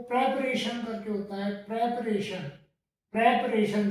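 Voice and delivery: प्रिपरेशन as pre-pa-re-shan, clear "pre"; correct pronunciation. Shown as a demonstration. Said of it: "सही इसका pronunciation जो होता है". (सही उच्चारण: प्रिपरेशन)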